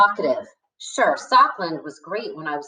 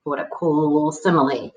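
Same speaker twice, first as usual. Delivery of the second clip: ordinary delivery, nothing marked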